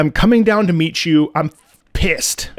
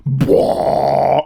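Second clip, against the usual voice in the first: tuba imitation; 3 semitones lower than usual. Shown as a demonstration. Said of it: "the tuba".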